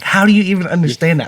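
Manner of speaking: mock-baffled, high and loud, rushed; incredulous wife. Wife questions his double-speed YouTube watching, incredulous. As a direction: imitate an exasperated, disbelieving wife.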